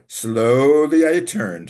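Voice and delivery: slow, loud, theatrical build; dramatic slow menace. Retold or imitated. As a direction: intone it like a vengeful madman. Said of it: "my favorite also".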